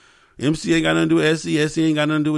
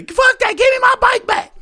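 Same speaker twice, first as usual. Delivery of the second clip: much higher, louder woman's shout; Trisha yelling; 5 dB louder than usual. Retold or imitated. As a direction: scream it like the furious girlfriend. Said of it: "Trisha say".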